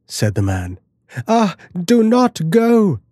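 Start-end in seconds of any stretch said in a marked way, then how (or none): 1.3-3.0 high, pleading cry; frightened cry. Acted. The wife begs her husband to stay, high frightened cry. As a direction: beg him not to go, afraid. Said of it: "cried the wife".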